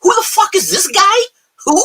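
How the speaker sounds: high, scoffing who, who; incredulous mock whos. Answering hater friends about Trey Lance, high and scoffing. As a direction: play dumb, high and scornful. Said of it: "I go".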